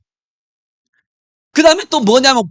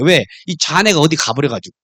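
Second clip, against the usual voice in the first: ordinary talk, nothing marked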